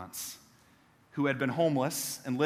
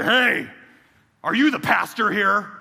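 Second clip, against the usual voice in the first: a loud, higher, blunt demand; gruff stranger's challenge; 5 semitones higher, 7 dB louder, slower than usual. Retold or imitated. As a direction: bark it at the pastor like a big angry biker. Retold scruffy stranger confronting pastor.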